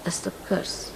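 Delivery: a hushed, breathless whisper; whispered repeat. Real, not acted. Guru repeating the curse of riches to disciples, whispered. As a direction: drop to a whisper to let it sink in.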